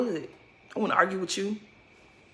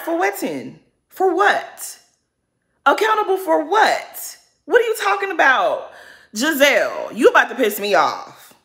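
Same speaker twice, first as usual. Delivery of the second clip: loud, high-pitched disbelief; indignant comeback; 7 semitones higher, 5 dB louder than usual. Retold or imitated. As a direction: demand an answer, pitched way up and loud. Indignant comeback to Giselle's claim.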